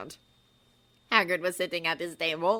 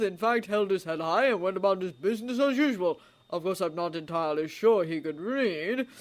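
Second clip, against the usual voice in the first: put-on louder, dry, amused old man; wry old wizard; 5 dB louder than usual. A narrator's reading. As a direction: tell it with dry wit. Dumbledore's wry story.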